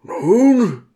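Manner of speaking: hoher, lauter Aufschrei; Schrei. A narrator's reading. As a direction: ruf es gespannt heraus. Diakon schreit angespannt. (lauter, hoher Schrei)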